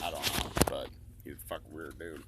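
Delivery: ordinary talk, nothing marked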